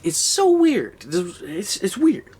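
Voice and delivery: high, loud disbelief; shocked high outburst; incredulous exclamation. Real, not acted. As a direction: squeal of baffled amusement. Reacting to absurd movie.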